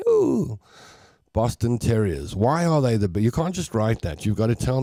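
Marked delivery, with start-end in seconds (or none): 0.1-0.6 high-pitched mock-startled "ooh"; wide-eyed "ooh". Imitating a Boston Terrier looking at the world, wide-eyed high "ooh". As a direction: coo it high like an astonished little dog. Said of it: "looking at the world like".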